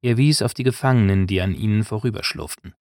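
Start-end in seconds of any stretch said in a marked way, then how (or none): none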